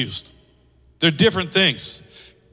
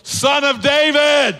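high, loud, drawn-out cry; belted call for attention; 6 semitones higher, 4 dB louder, slower than usual. Acted. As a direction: shout the title out slowly over a crowd. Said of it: "he was not only calling out".

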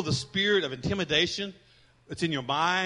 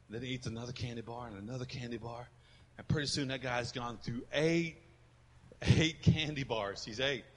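1 storytelling; 4 semitones lower, 4 dB quieter than usual. A narrator's reading. Tells a joke story softly.

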